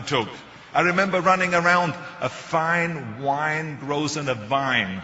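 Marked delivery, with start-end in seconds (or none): 2.2-5.0 slow, deliberate "fine wine grows in a vine"; diction drill. Shown as a demonstration. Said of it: "difficulties with the F and the W and the V".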